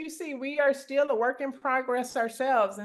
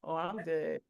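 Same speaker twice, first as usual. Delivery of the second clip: low, soft, lacklustre answer; subdued reply; 8 semitones lower, 10 dB quieter than usual. Retold or imitated. Mimicking pickleball players' unenthusiastic reply, subdued. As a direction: drop it down low and small. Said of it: "Like, they say".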